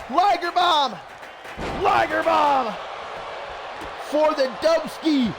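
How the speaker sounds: punchy play-by-play exclamation; excited move call. Real, not acted. Calling a big wrestling move.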